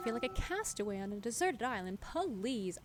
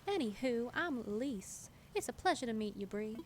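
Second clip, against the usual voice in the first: lilting Southern belle, pitched up; Elise, Southern accent; 3 semitones higher than usual. Acted. Villager introduces herself to Bree, put-on Southern accent. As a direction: introduce yourself in a Princess and the Frog accent. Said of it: "Princess and the Frog accent".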